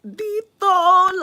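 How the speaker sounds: a sudden high, loud squeal. Real, not acted. Pausing mid-story about a coworker, sudden high squeal.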